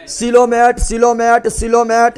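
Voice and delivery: steady emphatic chant, last one drawn out; drilling repetition. Shown as a demonstration. Teacher drilling the answer into the class, emphatic repeated chant. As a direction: hammer the term home, over and over.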